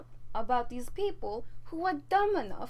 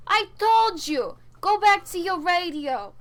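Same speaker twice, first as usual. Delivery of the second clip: loud, slow, exasperated yell; yelling at husband; 5 semitones higher, 7 dB louder than usual. Acted. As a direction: bark it at him across the room. Snaps at Frankie during interview.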